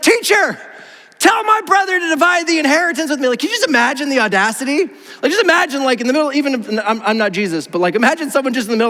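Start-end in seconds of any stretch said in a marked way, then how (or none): 0.0-3.3 high-pitched, insistent interrupting demand; pushy crowd member. Acted. Someone shoving through the crowd demands Jesus's help, pushy and high. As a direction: call out as if barging to the front. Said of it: "someone kinda just shoves their way to the front".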